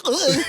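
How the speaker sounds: loud, high grossed-out groan; high disgusted ugh. Retold or imitated. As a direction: recoil in disgust. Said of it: "You're like".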